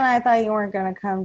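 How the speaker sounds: ordinary delivery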